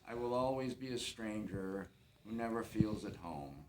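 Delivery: ordinary talk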